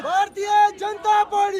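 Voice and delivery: high, shouted slogan call; rally slogan call. Real, not acted. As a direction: call it out to the crowd. Leader calling rally slogans.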